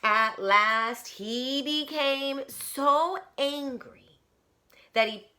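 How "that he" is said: ordinary delivery, nothing marked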